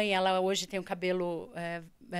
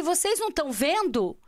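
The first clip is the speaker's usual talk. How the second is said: higher, incredulous retold question; astonished; 6 semitones higher, 4 dB louder than usual. Daughter asking her parents about her vision, astonished. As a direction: re-enact your disbelief at them.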